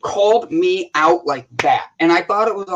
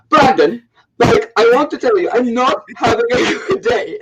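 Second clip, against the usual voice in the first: higher-pitched playful imitation of Ilsa's accent; 5 semitones higher, 3 dB louder than usual. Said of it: "I'm gonna use your accent".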